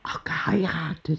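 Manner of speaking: a soft surprised whisper. Acted. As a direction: breathe it in amused disbelief. Oma Rosa reacts to marriage news. (geflüstert, leise und verblüfft)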